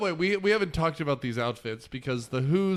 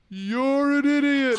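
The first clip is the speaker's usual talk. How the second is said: much louder, high, slow echo shout after the tirade; 10 semitones higher, 8 dB louder, slower than usual. Retold or imitated. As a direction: echo blasts it back. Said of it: "then he goes".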